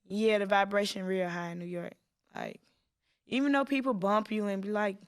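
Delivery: normal talking voice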